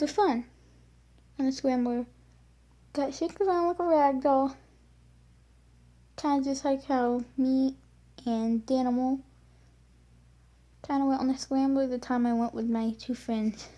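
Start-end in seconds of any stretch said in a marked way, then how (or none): none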